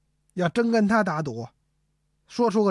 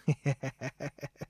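a soft, sly little snicker, much quieter; 5 semitones lower, 10 dB quieter than usual. Acted. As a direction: snicker quietly like a schemer with narrowed eyes. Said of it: "就得来个蔫损坏". (和珅眯着眼阴阴地轻笑)

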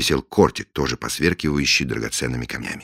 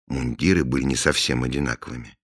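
ordinary delivery, nothing marked

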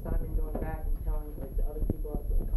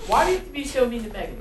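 louder, higher playful reproach; mock scolding; 7 semitones higher, 7 dB louder than usual. Student mock-scolding teacher for teasing Megan, loud and playful. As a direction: tease the teacher for picking on Megan.

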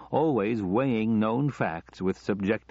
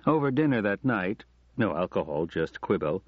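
ordinary delivery

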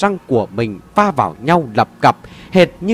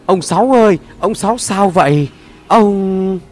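worried calling out; 5 semitones higher than usual. Acted. Kids calling out to Ông Sáu.

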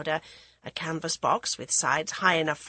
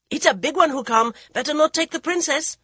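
urgent huntsman; 9 semitones higher, 7 dB louder than usual. Acted. Huntsman warns the Maharaja.

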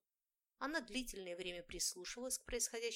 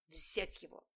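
angry hiss; 3 semitones lower, 5 dB louder, slower than usual. A narrator's reading. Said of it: "злобно прошипела я".

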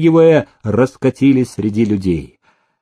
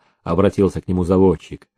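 ordinary delivery, nothing marked

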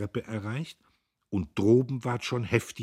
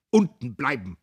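loud, higher barked order; commanding; 6 semitones higher, 9 dB louder than usual. A narrator's reading. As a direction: order them to stay downstairs. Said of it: "befahl er".

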